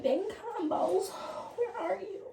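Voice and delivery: high, slowing sing-song call; playful searching. Pretend. Needing cotton balls for the face, a playful high-pitched search.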